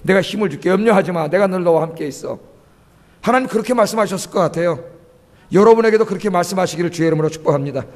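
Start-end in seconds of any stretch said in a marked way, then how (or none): 0.0-2.4 lower, gentle voice of God comforting Moses; a lightly voiced, calm divine father; voicing God. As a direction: speak as God reassuring a weary leader. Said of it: "그때 하나님".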